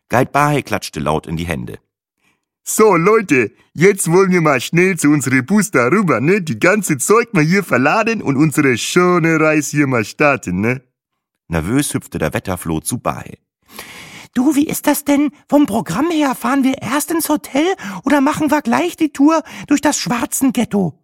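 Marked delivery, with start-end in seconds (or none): 2.7-10.8 loud, high African guide calling the group; rally the group in broken German. Acted. Guide claps and calls the travellers to the bus, loud. 14.3-21.0 very high, loud, fussy woman; nervous chirp. Acted. Nervous Trixie asks the guide, very high.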